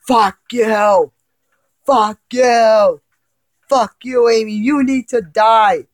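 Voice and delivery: loud, high furious yell; angry yelling. Acted. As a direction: scream it at the monster. Boy screaming at the thing.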